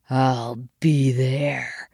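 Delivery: very slow, low-key menace; hissed reply. A narrator's reading. Coyote accepting the duel, slow hissed reply. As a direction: draw it out as a cold acceptance. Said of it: "Cody hissed back".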